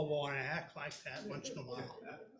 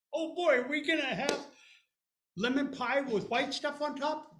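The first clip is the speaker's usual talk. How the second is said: put-on higher, louder eager kid; excited boy; 7 semitones higher, 7 dB louder than usual. Little boy hoping for lemon pie. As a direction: bounce with hope for pie.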